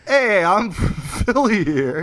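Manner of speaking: put-on slow, drawn-out Philly accent; hammed-up Philadelphia accent, slow. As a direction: lay on a thick Philly accent. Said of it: "I can't do the Philly accent".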